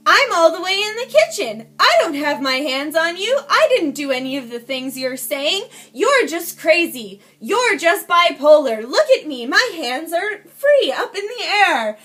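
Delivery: loud, emphatic protesting shout; imitated yelling. He yells from the kitchen to neighbors, imitated loud denial. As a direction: yell out each denial, stressing it hard. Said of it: "he starts yelling".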